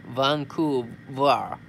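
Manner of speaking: "Vancouver" drawn out slowly with an English v; careful English pronunciation. Shown as a demonstration. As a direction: say "Vancouver" slowly, stressing the v sounds. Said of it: "Japanese accent".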